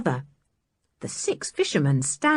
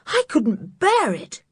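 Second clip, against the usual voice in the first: mild shudder; 7 semitones higher than usual. Acted. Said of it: "said George with a shudder".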